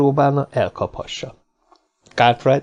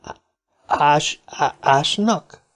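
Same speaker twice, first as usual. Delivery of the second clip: halting, stretched stammer, pitched higher; frightened stammer; 4 semitones higher, much slower than usual. A narrator's reading. Little man alarmed at hearing of digging, stammering in fright. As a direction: falter on the word, repeating its first sound.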